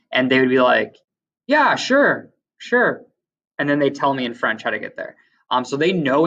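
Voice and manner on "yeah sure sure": a high, breezy "yeah, sure" that brushes off the tourist. Retold or imitated. Quebec clerk brushing off a lost tourist, airy and dismissive. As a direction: toss off an easy yes and then ignore the question.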